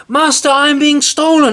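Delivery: very high, loud shriek for help; harp yelling. A narrator's reading. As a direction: scream to wake the master. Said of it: "yelled out".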